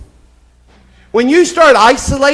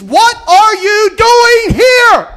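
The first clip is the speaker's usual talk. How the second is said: slow, loud, high preached shout; God in the cave booming the question slowly; 8 semitones higher, 4 dB louder, slower than usual. Acted. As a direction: thunder it out as God confronting Elijah. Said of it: "God shows up at the cave and says".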